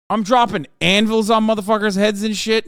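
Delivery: ordinary delivery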